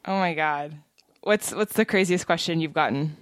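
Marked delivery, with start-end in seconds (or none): none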